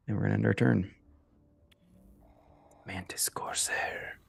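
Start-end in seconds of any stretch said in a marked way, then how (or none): 2.9-4.2 hushed whisper of the card name. Real, not acted. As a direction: breathe the name softly.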